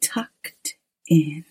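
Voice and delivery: hushed, slow spooky whisper; horror host. Acted. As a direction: whisper like a creepy storyteller host. Horror host introduces the story.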